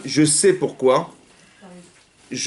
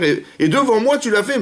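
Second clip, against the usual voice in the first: high-pitched outraged protest; Cain's wounded complaint, voice jumping higher; voicing Caïn, aggrieved; 10 semitones higher than usual. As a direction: play Caïn's hurt pride, pitched up.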